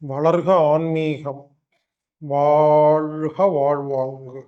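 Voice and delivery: slow, measured blessing with drawn-out words; solemn invocation. Acted. Speaker opens the class with a blessing, solemn slow invocation. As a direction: intone it slowly, stretching the final line long.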